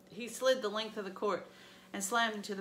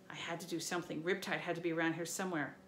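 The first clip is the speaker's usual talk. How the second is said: usual speaking voice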